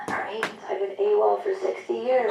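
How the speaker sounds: hushed, very soft retold confession; imitated whispering. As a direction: quote him under your breath. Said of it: "Why is he whispering?".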